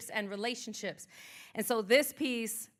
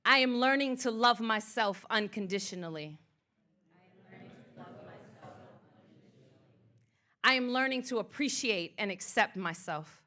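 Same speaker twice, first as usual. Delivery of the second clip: affirmation reading. Acted. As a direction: lead the room in affirmations, each line left to settle. Leads spoken affirmations.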